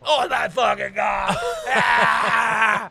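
loud, high wailing scream; acted howl of revulsion; horrified realization. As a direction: cry out as disgust dawns, then scream. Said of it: "the realization hits his face".

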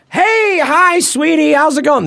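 high, sweet, chirpy fatherly greeting; dad's sweet phone voice. Retold or imitated. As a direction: pitch way up like a goofy sweet dad. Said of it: "This is really the way my dad talks".

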